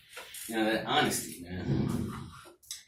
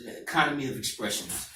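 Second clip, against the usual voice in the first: ordinary talk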